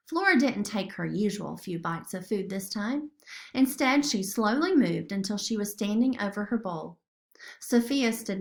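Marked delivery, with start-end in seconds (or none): none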